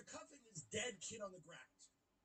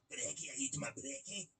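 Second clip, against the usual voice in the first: mock northern English accent, pitched lower; 6 semitones lower, 8 dB louder than usual. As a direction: imitate a northern English accent, loud and low. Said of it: "the northern accents".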